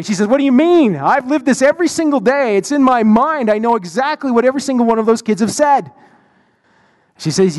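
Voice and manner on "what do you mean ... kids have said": retold exasperated outburst, pitched high; worked-up, high-pitched protest in retelling; angry protest. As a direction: act out her indignant comeback, higher and insistent. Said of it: "And she got angry".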